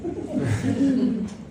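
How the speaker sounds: a high breathless giggle; high, airy giggle after an awkward answer. Acted. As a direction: giggle high and light at your own answer. Applicant laughs after answering.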